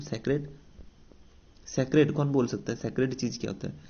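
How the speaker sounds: usual speaking voice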